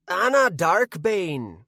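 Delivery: high-pitched yet gravelly Irish voice; slow, loud, gravelly little leprechaun. A narrator's reading. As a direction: growl it high, with an Irish accent. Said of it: "said the leprechaun in an Irish accent and high-pitched yet gravelly voice".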